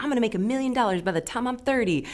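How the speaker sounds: high, quick voice of cocky ambition; lightly mock a person sure of their big plans; naive boaster. Teacher voicing humans who think they drive their lives, naive boast.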